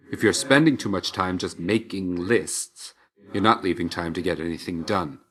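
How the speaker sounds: normal talking voice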